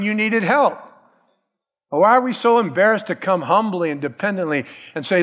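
ordinary talk

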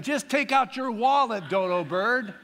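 loud, high-pitched comic reproach; exasperated mock scolding. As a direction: scold a dimwit in playful exasperation. Holy Spirit voiced scolding comically.

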